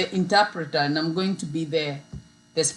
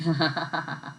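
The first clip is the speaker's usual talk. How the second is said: low, short chuckle; amused laugh; 3 semitones lower, 7 dB quieter than usual. Real, not acted. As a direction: chuckle softly at your own joke. Laughs at own saint joke.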